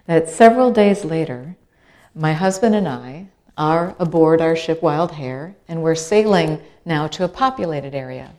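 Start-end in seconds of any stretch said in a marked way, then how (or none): none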